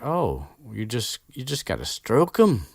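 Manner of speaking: a half-baked try at Hagrid; wrong Hagrid voice. Retold or imitated. Podcaster fumbling Hagrid's voice for listeners. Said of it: "That was the, totally the wrong voice".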